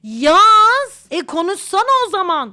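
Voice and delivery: impatient, demanding they get to it; loud impatient demand. Pretend. Answering a friend's "we need to talk" with a loud, impatient demand.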